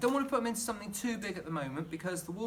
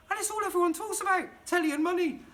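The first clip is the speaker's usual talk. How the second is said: exasperated; 11 semitones higher, 4 dB louder than usual. Real, not acted. Mocking what everyone talks about.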